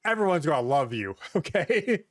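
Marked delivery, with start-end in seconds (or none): none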